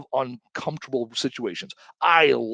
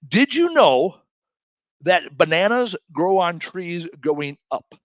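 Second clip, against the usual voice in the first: lightly voiced know-it-all dad, higher and louder; confident dad; 4 semitones higher, 5 dB louder, slower than usual. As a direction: mimic dad's sure tone, slowly. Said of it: "he said it with such confidence".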